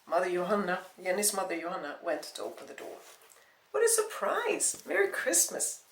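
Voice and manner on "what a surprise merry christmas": high-pitched, drawn-out welcome; delighted surprise. Acted. Mother greeting Kevin at the door on Christmas, delightedly surprised.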